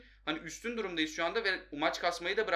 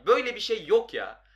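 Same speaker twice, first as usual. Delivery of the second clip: ordinary talk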